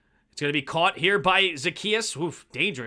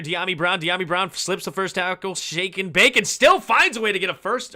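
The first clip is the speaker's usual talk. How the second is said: lively football call building to a louder, higher peak; live play-by-play; 3 semitones higher, 4 dB louder than usual. Real, not acted. Announcer to viewers as the screen pass develops, lively play-by-play. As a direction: call the play as it unfolds, rising on the breakaway.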